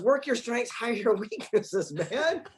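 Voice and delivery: put-on high-pitched cheeky kid retort; amused imitation of a boy's comeback; imitating his son. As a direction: toss it out like a confident youngster, high and drawn-out. Quotes his cheeky young son.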